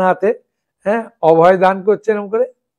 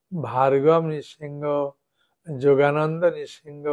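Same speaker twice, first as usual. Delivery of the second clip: slow recitation of names; 3 semitones lower, slower than usual. Shown as a demonstration. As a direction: recite the nine names slowly like a morning prayer. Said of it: "আপনি বললেন".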